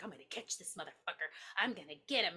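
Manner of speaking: mock scheming murmur. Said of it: "He's like".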